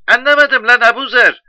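very high, loud wife on the phone; shouting wife. Acted. Wife not understanding her husband's news, high brusque shout. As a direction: yell back in a high voice, baffled.

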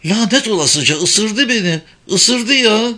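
high, loud outraged outcry; crying out. A narrator's reading. Woman bitten by the girl she comforted, high outcry. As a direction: cry out in shock at being bitten. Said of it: "çığlık atarak Evelyn'i yere itti".

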